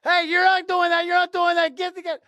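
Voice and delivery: high, loud frantic yelling; shrill-pitched imitation of screaming at someone; screaming cashier. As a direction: scream it like a panicked cashier at a robber. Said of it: "is screaming at this guy".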